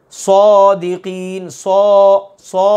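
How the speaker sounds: صادقین drawn out slowly with a thick heavy ص; heavy saad. Shown as a demonstration. استاد طالب علموں کو صادقین موٹا پڑھ کر دکھاتا ہے، بھاری اور کھینچی ہوئی آواز. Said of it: "یہ موٹا کر رہا ہوں میں".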